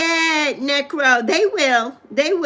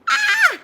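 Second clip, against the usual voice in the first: very high excited shriek; excited squeal. Real, not acted. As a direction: shriek with delight. Excited by Blizzard birthday.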